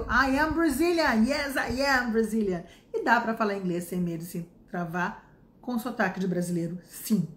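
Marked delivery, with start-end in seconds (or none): 0.0-2.6 slow, proud I am Brazilian; Brazilian accent. Shown as a demonstration. Teacher proudly showing her own accent. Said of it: "eu sou do Brasil".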